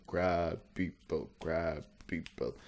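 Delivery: very slow, droning creature chant; one-dimensional crab people. Acted. Showing how the crab people came out, slow one-dimensional chant. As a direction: stretch each word out, monotone. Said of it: "came out real one-dimensional, kind of going".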